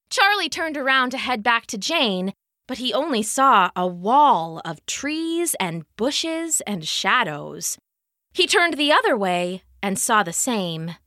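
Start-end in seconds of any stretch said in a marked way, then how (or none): none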